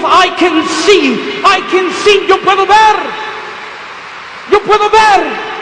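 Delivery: loud, high, drawn-out cry of joy; exultant shout. Retold or imitated. Newly sighted patient tells the doctors, exultant shout. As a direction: shout it out to the whole room.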